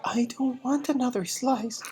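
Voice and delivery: put-on high whispered child's refusal; boy, whispered. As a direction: plead it high and breathless. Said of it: "the boy said".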